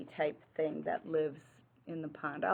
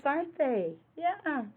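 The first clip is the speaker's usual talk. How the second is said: ordinary delivery, nothing marked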